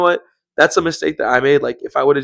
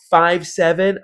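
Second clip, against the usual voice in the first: slightly raised, understated mimicry of a caller; Karen; 3 semitones higher, slower than usual. Employee giving police a height, mock woman's voice. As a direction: report it matter-of-factly in her imitated voice. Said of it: "on the phone and she's like".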